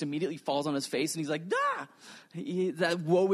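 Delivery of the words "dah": high yelp of dismay. Retold or imitated. Isaiah falling on his face.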